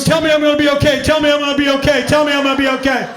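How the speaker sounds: imitating a panicking little brother; panicked begging. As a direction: beg for reassurance in a rush.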